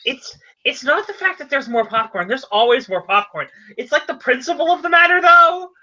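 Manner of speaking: rising, worked-up objection; indignant protest. Acted. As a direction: voice climbing high as you argue. Character argues about the popcorn.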